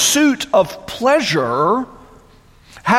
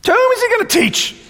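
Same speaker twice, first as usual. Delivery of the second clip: mocking question; 14 semitones higher, faster than usual. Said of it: "what do they say".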